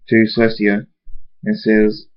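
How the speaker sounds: plain reading voice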